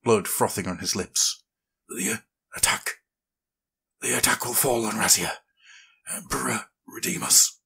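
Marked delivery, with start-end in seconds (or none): 1.9-7.6 breathless dying gasp. Acted. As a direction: gasp out words while wounded. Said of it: "Lothar gasped".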